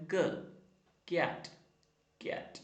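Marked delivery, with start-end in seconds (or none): 0.1-0.2 "k" as a short "kuh"; letter sound k. Shown as a demonstration. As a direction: say "k" as its phonics sound, not "kay". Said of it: "k എന്നും പറയും".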